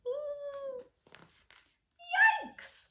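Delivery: very high startled yelp; high frightened starfish cry; scared starfish. Acted. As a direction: squeal in fright like a tiny starfish. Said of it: "say the little starfish".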